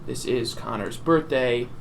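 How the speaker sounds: ordinary delivery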